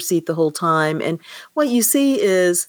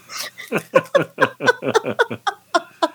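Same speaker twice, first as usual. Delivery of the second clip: rising, helpless laugh; real laughter; 11 semitones higher than usual. Real, not acted. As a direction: burst out laughing at the scene. Reader cracks up after passage.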